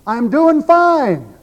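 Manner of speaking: loud, high, drawn-out holler; yelled call. Acted. Falling man to people inside as he passes each story, yelling. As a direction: holler it long, like calling through a window. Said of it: "he yells through the window".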